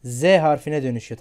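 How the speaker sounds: normal talking voice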